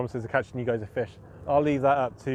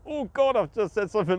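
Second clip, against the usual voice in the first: higher-pitched pretend alarm; mock dismay; 6 semitones higher than usual. Reassuring a friend about blind-related slips, acted dismay. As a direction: act out a sudden awkward realisation. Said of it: "So don't ever feel".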